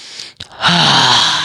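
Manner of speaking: big gasping inhale; deep breath in. Acted. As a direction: suck in air after being smothered. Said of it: "takes a deep breath in".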